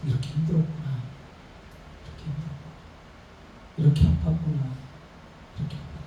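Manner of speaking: soft, dropping to a whisper; gentle empathetic murmur; tender empathy. Retold or imitated. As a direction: comfort someone who is hurting. Voicing empathetic comfort.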